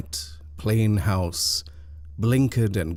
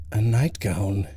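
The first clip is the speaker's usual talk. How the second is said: ordinary delivery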